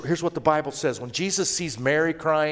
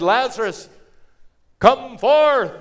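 high, loud summons; commanding call; 8 semitones higher, 8 dB louder, slower than usual. Acted. Jesus calling Lazarus out of the tomb, commanding shout. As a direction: call him out with full force. Jesus calls the dead man out.

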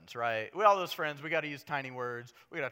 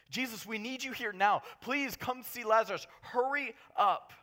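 higher, louder pleading call, slowing at the end; urgent plea; 9 semitones higher, 8 dB louder than usual. Acted. Sisters sending word to Jesus, urgent pleading call. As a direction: beg him to come now.

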